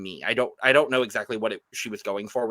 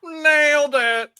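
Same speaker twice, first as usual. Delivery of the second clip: drawn-out, high, loud cheer; excited announcer whoop; 12 semitones higher, 9 dB louder, much slower than usual. Real, not acted. Hosts hailing the next queen up for review, excited high whoop. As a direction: belt it out high and long.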